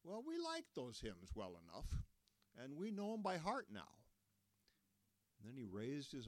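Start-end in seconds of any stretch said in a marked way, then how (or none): 0.0-3.9 put-on higher old man, a touch sheepish; Lloyd's voice. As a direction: defend the old hymns. Lloyd defends the hymns.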